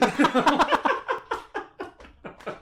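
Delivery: high burst of laughter; loud laugh. Real, not acted. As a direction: crack up laughing. Laughing at own fake German.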